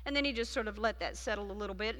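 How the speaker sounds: usual speaking voice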